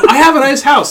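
high, loud mocking echo; mock quote. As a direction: throw the claim back loud and high-pitched. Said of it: "You opened the door and said".

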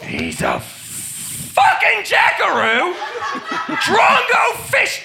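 put-on Australian accent. Aussie leader yelling insults to the mob. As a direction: bellow the slang through laughter. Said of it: "Aussie slang rant".

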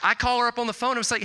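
ordinary delivery

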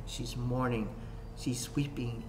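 usual speaking voice

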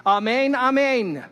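high, loud and slow, intoned like a rabbi; solemn rabbi's amen. Retold or imitated. Rabbis closing the prayer, a solemn intoned amen. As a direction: draw out each amen solemnly. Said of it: "they would say".

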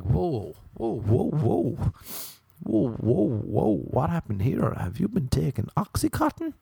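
high, rising shocked whoas; mock shocked friend. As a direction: act stunned at the sight. Friend shocked at his looks.

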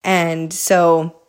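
normal talking voice

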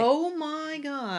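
surprised. Real, not acted. Cohost hearing of peach Crown Royal, slow amazed gasp. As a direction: let the surprise stretch out slowly.

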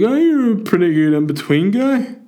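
high, exaggerated fan impression; drunk young fella. Drunk fella complimenting the comedian, high put-on voice. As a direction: mimic a drunk punter's clumsy compliment, pitched right up. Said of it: "But he's like".

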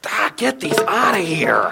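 slow, loud groan of effort; strained by burning shoulders. Retold or imitated. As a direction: grunt it out under a heavy load, hammed-up. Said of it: "my shoulders are burning".

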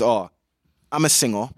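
ordinary talk

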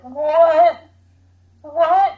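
baffled. Retold or imitated. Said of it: "I was like".

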